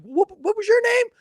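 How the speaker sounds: high, bright mock-charming question; friendly cop. Cop asking the witness's name, high mock charm. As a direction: play the cop buttering up a witness.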